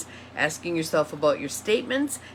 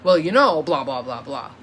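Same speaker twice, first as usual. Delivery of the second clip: loud, assertive start falling into low blahs; opinion as fact; 4 semitones lower, 6 dB louder than usual. Acted. Teacher mimicking someone stating opinion as fact, loud and assertive at first. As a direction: announce it like settled fact, then drop away. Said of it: "Have I stated my opinion as fact?".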